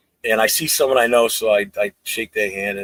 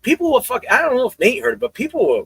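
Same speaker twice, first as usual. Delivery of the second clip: higher-pitched exasperated rant; retold rant; 13 semitones higher than usual. Telling a Patriots fan their fans are overreacting, higher and exasperated. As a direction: rant at them like you can't believe it. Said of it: "I'm like".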